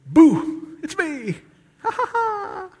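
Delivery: high-pitched peekaboo prank with mock laugh; playful boo. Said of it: "Jesus would go".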